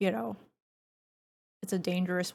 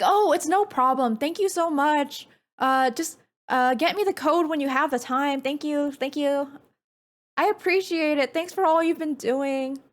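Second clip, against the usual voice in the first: higher and louder, chirpy and friendly; customer service voice; 5 semitones higher, 3 dB louder than usual. Shown as a demonstration. Demonstrating her friendly stranger voice, bright customer service tone. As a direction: sound as harmless and approachable as you can. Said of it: "the customer service voice".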